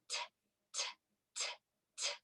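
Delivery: crisp voiceless "t", evenly spaced; ticking letter sound. Shown as a demonstration. Teacher asking which letter this is, ticking "t" sounds. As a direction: tick "t" like a clock. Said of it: "like a ticking clock".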